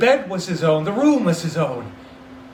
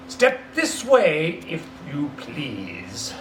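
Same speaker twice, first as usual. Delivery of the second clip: mock-stern invitation; 2 semitones lower, much slower than usual. Scrooge luring Bob in, mock threatening. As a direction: lure him in with pretend menace.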